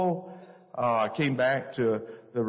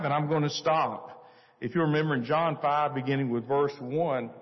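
ordinary talk

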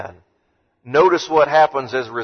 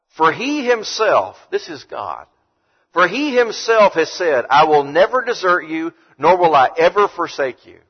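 scripture reading; 3 semitones higher, 3 dB louder than usual. Acted.

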